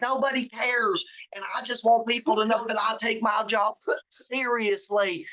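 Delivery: retelling in a put-on Southern voice; Southern accent. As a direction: do her down-south Arkansas accent. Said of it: "like, down south".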